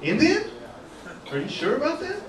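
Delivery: high, incredulous rising question; baffled question. Retold or imitated. Comedian answering the girl at the nightclub, baffled question. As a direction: squeak it up in mock disbelief.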